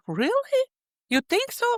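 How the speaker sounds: touched surprise. Retold or imitated. Retelling her touched surprised reply.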